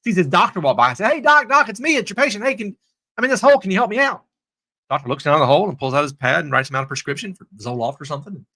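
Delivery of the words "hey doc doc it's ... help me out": high mock plea, pitched upward; acted calling out. Man in a hole hails his doctor, acted urgent plea. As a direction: hail someone overhead for rescue.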